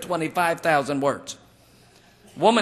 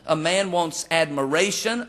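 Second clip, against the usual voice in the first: ordinary delivery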